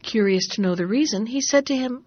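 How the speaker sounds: usual speaking voice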